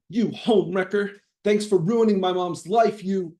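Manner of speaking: put-on high, loud angry teen yelling. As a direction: yell it at the cheater. Teen yelling while filming.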